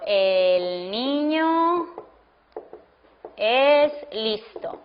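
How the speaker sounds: word by word, with long gaps between words; slow dictation. Shown as a demonstration. Teacher to class, dictating an example sentence slowly. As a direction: dictate it slowly so students can write.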